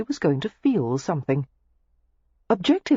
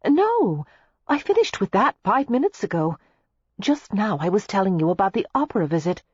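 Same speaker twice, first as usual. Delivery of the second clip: higher, exasperated correction; impatient reply; 4 semitones higher than usual. A narrator's reading. Daisy setting straight a confused listener, impatient. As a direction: correct him with strained patience. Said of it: "Daisy had replied impatiently".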